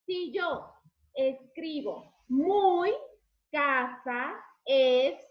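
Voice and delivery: slow, word by word; slow jumbled sentence. Shown as a demonstration. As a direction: read it out slowly as a jumbled sentence. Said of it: "¿me entenderían?".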